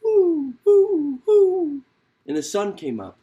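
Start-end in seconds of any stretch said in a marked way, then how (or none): 0.0-1.8 high, loud owl hoots; Mother Owl hooting to the east, high and loud. Acted. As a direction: hoot like an owl waking the sun.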